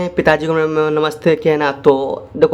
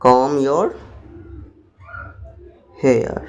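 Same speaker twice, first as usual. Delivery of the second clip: very slow, word by word; slow dictation; 4 dB quieter, much slower than usual. Shown as a demonstration. Teacher dictating to students, very slow. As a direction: draw each word out for learners. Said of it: "बालों को कंघी करो".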